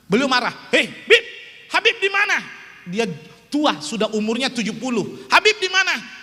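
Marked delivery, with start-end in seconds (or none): 0.7-2.4 put-on loud, higher calling out of an old doctor; doctor scolding. Elderly professor scolding the preacher for skipping checkups, mock angry. 5.3-6.2 put-on loud, higher calling out of an old doctor; doctor scolding. Elderly professor scolding the preacher for skipping checkups, mock angry.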